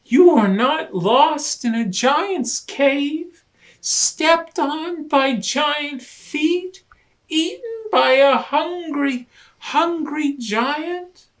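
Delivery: high, loud worried asking; anxious rush of questions. Acted. Rabbit quizzes Hermit Crab, high anxious questions. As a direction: pile up the worries.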